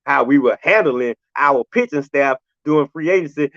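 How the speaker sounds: ordinary delivery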